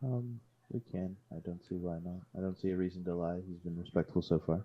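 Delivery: confer quietly, out of others' earshot; quiet in-character murmur; hushed aside. Acted. Answers a teammate's whispered question.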